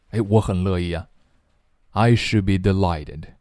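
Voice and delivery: ordinary delivery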